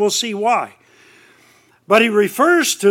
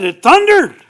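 mock crowd exclaiming; 9 semitones higher, 7 dB louder than usual. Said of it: "Everybody around said".

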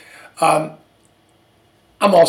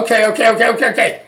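exasperated okays; 4 semitones higher, 5 dB louder, much faster than usual. Retold or imitated. Impatient giving in to doctor.

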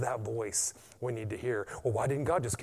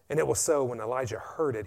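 ordinary talk

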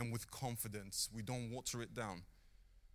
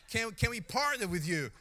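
high, loud imitation American; mock American accent; 8 semitones higher, 7 dB louder than usual. American visitor asking to partner, loud imitated accent. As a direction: put on an eager American's voice, loud. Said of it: "I don't know what's the accent".

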